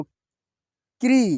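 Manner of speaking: क्री stretched very slowly, every sound held; drawn-out sounding out. Shown as a demonstration. Teacher sounding out क्री slowly for pupils. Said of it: "teacher sounding out syllable".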